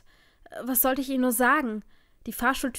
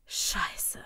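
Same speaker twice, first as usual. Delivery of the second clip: breathed curse; 4 dB quieter than usual. A narrator's reading. Jaden's words as she faints.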